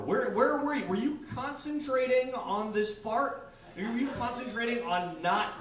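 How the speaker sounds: cross-examine her in disbelief; incredulous interrogation. Retold or imitated. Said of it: "I'm like".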